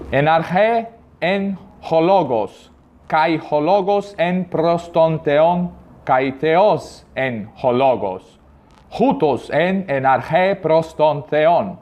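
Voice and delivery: very slow classical Greek, "arche" with an e ending; reconstructed Greek, each syllable drawn out; Erasmian Greek. Shown as a demonstration. Teacher reads the same verse in Erasmian Greek. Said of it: "E na pronúncia clássica".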